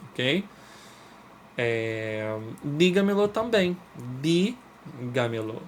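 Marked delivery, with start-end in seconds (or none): 2.6-3.3 DÍGAMELO high, weight on DÍ; stress on first syllable. Shown as a demonstration. Teacher returning to the second example, dígamelo stressed on DÍ. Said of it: "o di é a sílaba tônica". 4.2-5.6 DÍ held long, pause, then gamelo; isolated stressed syllable. Shown as a demonstration. Teacher showing which syllable is tonic, dígamelo broken after stressed DÍ. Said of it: "o di é a sílaba tônica".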